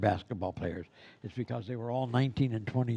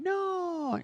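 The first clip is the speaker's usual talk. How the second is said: put-on very high shouted child's no; kids yelling no. Kids shouting back at the clown, high mock yell.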